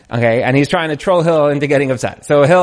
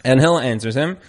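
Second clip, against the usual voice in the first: usual speaking voice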